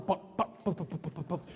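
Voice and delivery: soft pops fading away; quick playful pop noises; popping sounds. Pretend. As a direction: mimic popcorn popping. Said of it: "Look at this popcorn".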